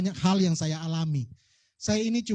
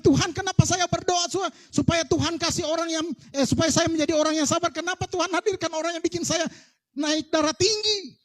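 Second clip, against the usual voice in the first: mock exasperated complaint; 7 semitones higher than usual. Mock complaining prayer to God.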